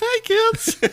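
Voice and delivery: put-on high squeaky kids' clown. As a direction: greet the kids like a children's clown. Playing the clown greeting.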